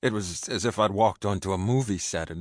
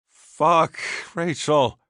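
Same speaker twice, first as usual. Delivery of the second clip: loud, higher, alarmed outburst; panicked cry; 9 semitones higher, 8 dB louder, much slower than usual. A narrator's reading. Panic at the scene.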